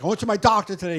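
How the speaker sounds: put-on a boy back from the doctor, happy; happy kid. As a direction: share the good news eagerly. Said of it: "He came home all happy".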